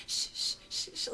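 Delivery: put-on soft whispered shh like a little girl calming mom; gentle shushing. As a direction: hush softly, as if rocking someone. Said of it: "like that".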